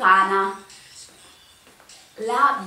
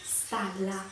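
stage whisper; 5 semitones lower, 15 dB quieter than usual. Acted. Whispered game clue.

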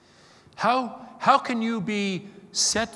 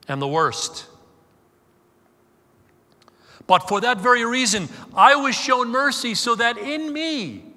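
full-force preaching; 2 semitones higher, 4 dB louder, slower than usual. Real, not acted. Preacher proclaiming grace to the congregation, loud and ringing. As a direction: preach it out to the back row.

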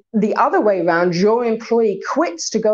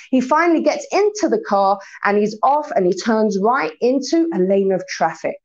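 ordinary talk, nothing marked

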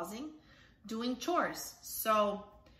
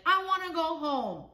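put-on whiny kid; 6 semitones higher, 4 dB louder than usual. Kids whining at the grocery store.

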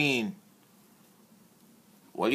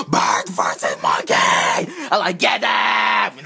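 excited shouting; slower than usual. Acted. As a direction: cheer on the brawl. Excited cheer for the toy fight.

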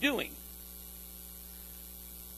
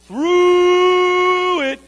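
high, loud, slow; weary groaning call; long drawn-out wail; 11 semitones higher, 12 dB louder than usual. Acted. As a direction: hold "through" long and high. Preacher stretching the phrase.

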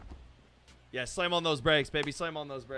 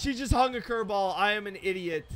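ordinary talk

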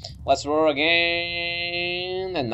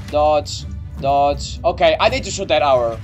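ordinary delivery

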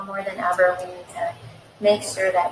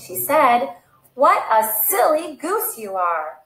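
lively read-aloud storytelling voice; animated picture-book reading; story narration; 3 semitones higher than usual. Acted. Reads picture book to children.

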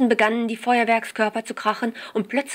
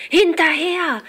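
much higher and louder command; urgent demand; 9 semitones higher, 6 dB louder, slower than usual. A narrator's reading. Jill ordering Jack to chase the taxi, loud. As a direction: push him after the taxi. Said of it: "forderte Jill".

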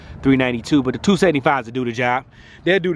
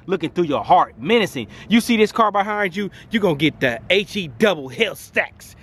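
ordinary delivery

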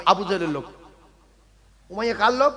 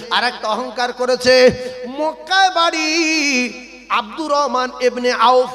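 loud, drawn-out sermon cadence; fiery preaching; 3 semitones higher, 7 dB louder, slower than usual. Acted. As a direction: hammer each line at the crowd. Preaching at full force. (জোরালো ওয়াজের সুরে টেনে টেনে বলা)

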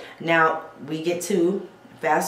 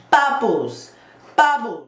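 BUBBLES punched high and loud; emphatic demand; 7 semitones higher, 6 dB louder, slower than usual. Real, not acted. Showing viewers the foam they need, emphatic. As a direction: hit the first syllable of "bubbles" hard, pitch falling.

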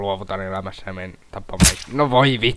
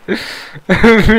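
loud high laugh; high burst of laughter; 11 semitones higher, 11 dB louder than usual. Real, not acted. Gamer laughing at a fail, loud high laugh.

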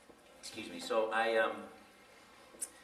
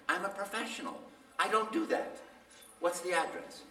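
mock dignity; 8 semitones higher than usual. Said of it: "And I'm like".

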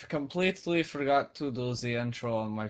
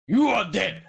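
higher, louder gloating shout; triumphant yell; 8 semitones higher, 9 dB louder than usual. Real, not acted. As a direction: crow over the fallen enemy. Kills the Vindicator mob.

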